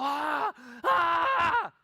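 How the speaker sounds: loud, high imitation of yelling men; high-pitched mock battle yells; imitated men shouting. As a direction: scream out like a gang of shouting men. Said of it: "como cuatro hombres gritando".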